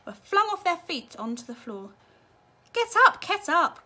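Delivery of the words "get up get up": high, loud, slow call through laughter; gleeful shout; laughing cry. A narrator's reading. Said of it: "cried Mister Wonka, roaring with laughter".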